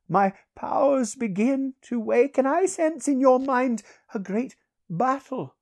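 high, measured, commanding sorcerer voice; awakening sorcerer. Acted. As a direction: pronounce visions in a high, ringing voice.

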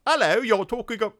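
a lightly hammed-up Brit, fast; brief mock British voice; mock British accent. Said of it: "he's British".

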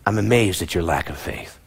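low, soft, let-down amazement; disappointed. Acted. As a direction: sigh it out in quiet disbelief. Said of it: "Jesus is like".

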